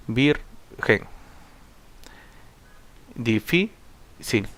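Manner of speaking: "margen, joven, virgen" split, first syllable leaning, then a gap; stress on penultimate syllable. Shown as a demonstration. Teacher reciting graves examples like "jueves", stressing the penultimate syllable. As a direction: lean on the next-to-last syllable, as in "César", "difícil". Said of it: "la mayor fuerza de voz es en la penúltima sílaba".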